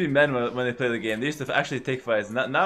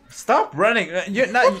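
shouting; 7 semitones higher, 7 dB louder than usual. Real, not acted. Yelling at fleeing units.

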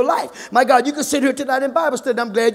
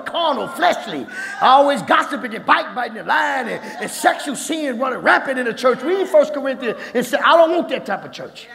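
normal talking voice

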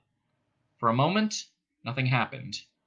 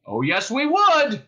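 loud, high, drawn-out insistence; shouting back; 10 semitones higher, 6 dB louder, slower than usual. A narrator's reading. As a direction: shout it slowly and firmly. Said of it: "shouted the cop".

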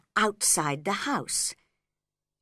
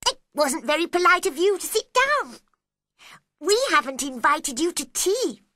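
much higher, louder mocking echo; March Hare mocking; 10 semitones higher, 6 dB louder than usual. A narrator's reading. March Hare mocks Alice back, higher and louder. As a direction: rude hare throwing her words back. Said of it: "said the March Hare".

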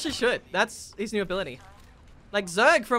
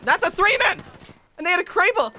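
alarmed shout; 9 semitones higher, 7 dB louder than usual. Real, not acted. Alarmed repeat.

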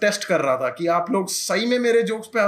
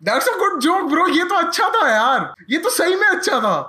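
higher, louder, pleased; higher excited self-praise; 7 semitones higher, 3 dB louder than usual. Acted. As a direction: sell it with delight. Genuinely pleased by own joke.

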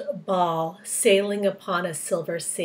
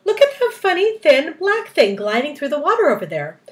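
excited; 6 semitones higher, 6 dB louder than usual. A narrator's reading. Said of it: "cried the centipede".

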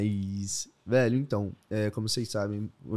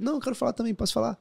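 eager self; 12 semitones higher, 3 dB louder, faster than usual. Retold or imitated. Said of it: "eu falei".

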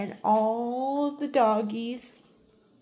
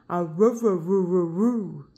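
low playful roo-roo howl; mock dog howl; 5 semitones lower, 3 dB louder, slower than usual. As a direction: howl along with the dog like a pup. Owner howls back at dog.

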